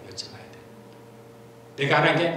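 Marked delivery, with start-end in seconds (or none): 0.1-0.6 여쭤봐야 돼요 repeated as a breathy whisper; whispered echo. Real, not acted. Pastor repeats his point to the congregation in a whisper. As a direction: whisper the words back to yourself. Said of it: "하나님께 여쭤봐야 돼요".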